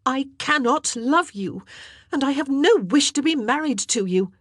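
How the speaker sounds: high, loud outburst at the king; flaring up. A narrator's reading. Queen snapping at the king, high and loud. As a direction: let her patience snap. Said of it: "she flared".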